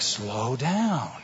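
acted plea, stretched out slowly and softly; slow, softened mock caution; drawn-out slow down. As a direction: draw out both words, pleading for restraint. Acted plea at expensive restaurant.

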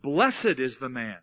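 "Blessed" hit hard and drawn out; stress on BLESSED. Shown as a demonstration. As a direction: lean slowly on the word "Blessed". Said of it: "and you could emphasize".

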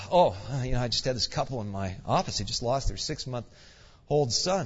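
subdued, halting report to a superior; wiped out. Retold or imitated. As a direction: retell it as a drained junior pastor. Retelling his dazed, wiped-out reply.

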